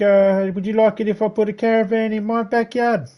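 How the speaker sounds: high, strained complaining neighbour voice; mocking the neighbour. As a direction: imitate a whiny neighbour, pitched right up. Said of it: "And he's like".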